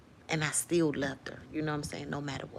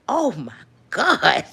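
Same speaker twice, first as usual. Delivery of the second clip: slow, loud, higher gasp of realisation; mock shock; 5 semitones higher, 10 dB louder, much slower than usual. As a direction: stretch it out in shock. Shocked reaction in retold scene.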